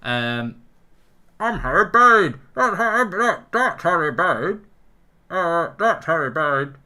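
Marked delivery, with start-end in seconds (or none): 1.4-6.8 high, loud, slow hammed-up Bane voice; Bane impression. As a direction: overblown villain growl gone high.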